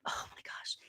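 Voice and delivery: unvoiced amazed whisper. Real, not acted. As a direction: breathe it out in a whisper. Reacting to spirit's teasing.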